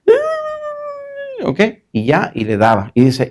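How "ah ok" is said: put-on very high, long drawn child's "ah"; resigned little boy. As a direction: sigh it out like a defeated kid.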